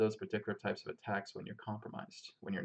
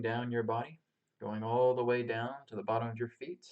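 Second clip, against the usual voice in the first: calm, unhurried meditation guide; grounding meditation. Acted. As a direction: lead them gently through the breath and body. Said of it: "we're gonna start off with a grounding meditation".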